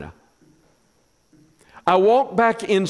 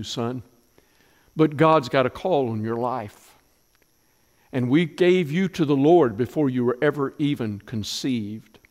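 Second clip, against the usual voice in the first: mother's farewell; 5 semitones lower than usual. Acted. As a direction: hold back the tears as you let him go. Hannah voiced farewell to son.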